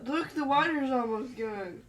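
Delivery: ordinary talk, nothing marked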